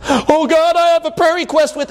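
high, quick pleading voice; hammed-up eager prayer; mock eager petitioner. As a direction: play a chirpy believer bringing a request. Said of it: "mock petitioner praying".

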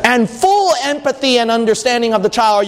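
ordinary talk

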